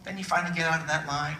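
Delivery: ordinary delivery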